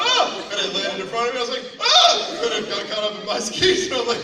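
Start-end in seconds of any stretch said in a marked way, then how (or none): 0.1-0.5 very high, loud mock shriek; sudden retold scream of alarm; alarmed yelp. Retells ski flying at him. 1.8-2.4 piercingly high, loud yelp; sudden retold scream; alarmed scream. Retells ski landing in front.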